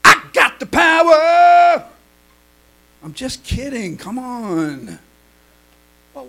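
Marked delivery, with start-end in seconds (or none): 0.0-1.8 mock triumphant boast. Boasting after dog falls.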